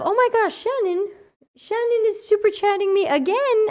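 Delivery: excited. Real, not acted. Excited at friend's chat.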